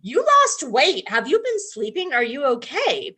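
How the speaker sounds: imitating a caring friend's concern; concerned Venestra. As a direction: fuss over him like a worried friend. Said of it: "Venestra comes to his office and she's like".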